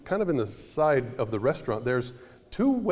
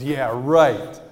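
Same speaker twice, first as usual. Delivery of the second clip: loud sarcastic yeah right; disbelieving; 8 dB louder than usual. Retold or imitated. Jogging woman scoffing at his real name, loud and sarcastic. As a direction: scoff at him.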